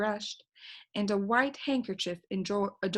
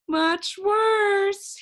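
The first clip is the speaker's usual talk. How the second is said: high, far-off longing bird voice; sing-song longing from the bird; 12 semitones higher, 8 dB louder than usual. A narrator's reading. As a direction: sigh it out wistfully, high up. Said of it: "he said longingly".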